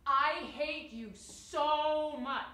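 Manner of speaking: shouted slow and loud; screamed hate line. Shown as a demonstration. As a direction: yell it out, every word dragged. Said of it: "you don't go".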